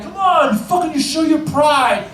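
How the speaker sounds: put-on drunk fan yelling. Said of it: "he starts yelling at the guy".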